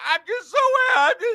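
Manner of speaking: high, wobbly whiny mock sob; mocking crybaby. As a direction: blubber it like a weepy star. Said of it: "Dude cries everywhere he's at".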